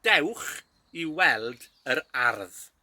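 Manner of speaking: Dewch i weld yr ardd very slowly; teacher slowing the phrase for practice; slow model phrase. Shown as a demonstration. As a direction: drag each word out for learners.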